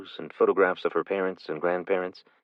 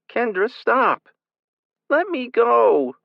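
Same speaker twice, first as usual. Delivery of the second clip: much higher, loud, drawn-out scream; 7 dB louder, slower than usual. A narrator's reading. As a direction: scream it slowly at the top of your lungs. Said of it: "Kylie screamed at the top of her lungs".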